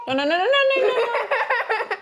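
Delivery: high, loud, laughing protest. Real, not acted. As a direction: laugh out the no's. Child messing food, laughing protest.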